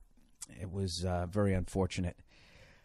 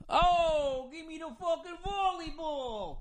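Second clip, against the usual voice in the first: much higher put-on dopey voice; dumb beach guy; slower than usual. Mocking tanned Jersey Shore morons, much higher voice. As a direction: squeak it out like a vapid beach bro.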